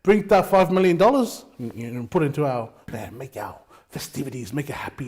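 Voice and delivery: lightly voiced leader, muttering his wishes; mock Lauli mumble. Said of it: "The man who says".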